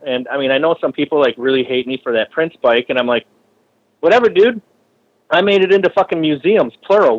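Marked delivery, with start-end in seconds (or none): 4.0-7.2 defiant. Retold or imitated. Retort to people who hate him.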